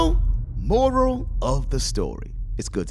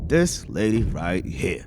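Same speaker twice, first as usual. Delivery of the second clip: ordinary delivery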